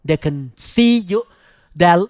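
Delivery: usual speaking voice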